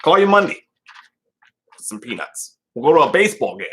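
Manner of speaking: erratic character voice, mumbles and outbursts; loose, halting character impression; Doctor Chalice. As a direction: jump from low mutter to sudden call. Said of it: "How do you even do Chalice".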